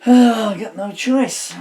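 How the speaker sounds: fed up with writing on creased paper, a loud slow groan of words; exasperated outburst. Real, not acted. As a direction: blurt it out loud and drawn out, fed up. Frustrated, out of paper.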